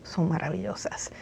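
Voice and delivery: whispered softly. Real, not acted. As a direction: lean in and whisper it. Soft, intimate point.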